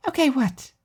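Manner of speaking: put-on high, airy girl's voice for Alice; Alice's voice. A narrator's reading. Narrator voicing Alice musing about new rats, high girl's voice. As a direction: voice the odd, dreamy friend lightly and high.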